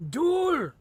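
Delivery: high, loud cry of Duel; shouted challenge. Acted. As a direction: bellow the challenge. Character calls a duel.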